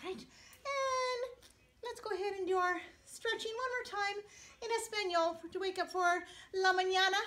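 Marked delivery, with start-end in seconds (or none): none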